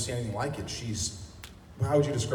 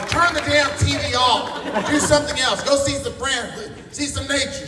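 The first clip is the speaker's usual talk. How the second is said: higher, louder, slow exaggerated nagging mom; imitating mom; 14 semitones higher, 7 dB louder, slower than usual. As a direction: ham up a scolding mother, high and drawn out. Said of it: "memorize my mom, go".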